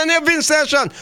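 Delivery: ordinary delivery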